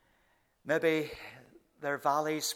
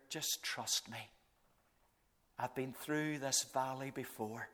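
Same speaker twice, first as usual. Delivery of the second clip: gentle whisper; 3 semitones lower, 8 dB quieter than usual. Retold or imitated. Jesus whispering to the praying believer, soft hush. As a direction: hush it like a secret promise. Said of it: "you whisper in our ear".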